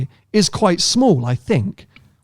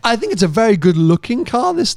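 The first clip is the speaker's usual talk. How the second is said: usual speaking voice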